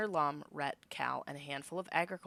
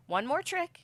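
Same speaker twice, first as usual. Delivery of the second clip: high, louder sly announcement; teasing; 12 semitones higher, 6 dB louder than usual. Acted. The hero sets up a trick aimed at Lum, slyly high. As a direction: tease it with a grin.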